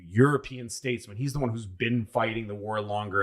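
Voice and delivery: ordinary talk, nothing marked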